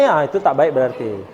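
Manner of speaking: ordinary talk, nothing marked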